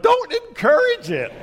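very high, strained mock plea; exasperated plea. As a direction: squeak it out in comic desperation. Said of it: "And I'm like".

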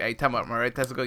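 rushed, quick and low, jumping in to finish the doctor's sentence; hurried interruption. Retold or imitated. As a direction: blurt it out fast to cut him off. He cuts the doctor off.

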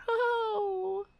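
high, slow sarcastic mock fright; mock scared voice. Mocking the politician, high sarcastic fake fear. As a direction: sneer at her fear in a high voice.

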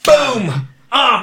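mock-triumphant showman yell; hammed-up hype. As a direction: crow it to the crowd like a cheap comic. Hammed-up punchline celebration.

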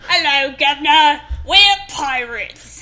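loud, slow hammed-up pirate talk; pirate accent. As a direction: bellow it like a stage pirate. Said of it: "I'm so good at accents".

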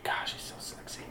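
breathy swooning whisper; hushed admiring whisper. Real, not acted. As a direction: sigh out a crush. Swooning over Idris.

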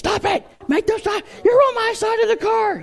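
put-on very high-pitched squabbling child voice; squeaky mock tattling and complaining; arguing kids, imitated. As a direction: mimic siblings bickering in the back seat. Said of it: "the kids started arguing".

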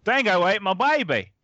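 a hint of an Australian accent; a hammed-up Aussie line; mock Australian accent. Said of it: "Okay, here we go".

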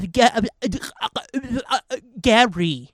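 very slow, stuttering robot, laughing through; a robot voice skipping and stalling; glitching robot. Retold or imitated. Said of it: "when it starts, like, skipping".

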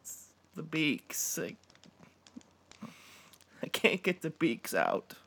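high, strained agonised cry; slow, high-pitched pained wail. A narrator's reading. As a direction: force the words out through agony. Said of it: "scream out in pain".

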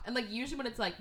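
normal talking voice

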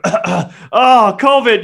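high, loud yelp; high, loud Superbad-style shriek; Seth from Superbad. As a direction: scream it like a teen comedy freakout. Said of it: "the fucking Seth from Superbad thing".

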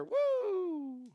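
sudden squeal far above the voice; high whoop. Real, not acted. Praising Roscoe for taking the cracker, high squeal. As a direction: cheer the pet on. Cheering the pet.

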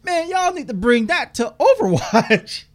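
high, slow, put-on hype voice; excited fan voice. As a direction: gush like an amazed fan. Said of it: "I was like".

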